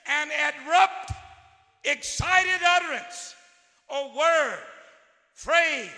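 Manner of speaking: loud, punchy reading of the definition; preacher punching out the meaning of exclamation; emphatic definition. Shown as a demonstration. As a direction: hit each word like an exclamation. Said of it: "The word exclamation means this".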